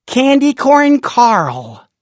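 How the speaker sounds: slow, high and loud announcement; grand reveal. A narrator's reading. Storyteller proudly unveiling Rumple's candy friend, drawn-out fanfare. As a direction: unveil the name like a proud inventor.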